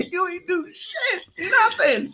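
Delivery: high, cracking up mid-line; wheezing laughter through the words; laughing. Pretend. Laughing mock reply to client.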